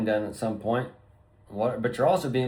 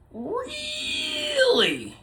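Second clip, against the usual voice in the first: mock squeak. Mock incredulous reply in rant.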